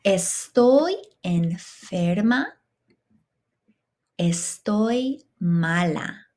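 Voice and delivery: slow, carefully spaced phrases for students to repeat; slow model phrases. Shown as a demonstration. Teacher to class, slow clear model to repeat. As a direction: lay out each phrase slowly, one at a time.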